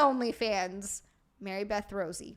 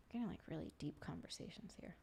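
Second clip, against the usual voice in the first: hushed, unvoiced whisper, much softer; 7 semitones lower, 19 dB quieter, faster than usual. Real, not acted. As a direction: whisper it like a secret aside. Jokes about deep conversations.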